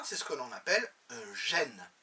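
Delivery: usual speaking voice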